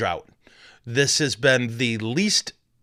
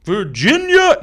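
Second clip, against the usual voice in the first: Virginia? much higher and louder, testing it; a mock shout-out test; trying the shout-out; 11 semitones higher, 9 dB louder, slower than usual. Shown as a demonstration. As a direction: try it like a DJ call. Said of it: "No, it doesn't work".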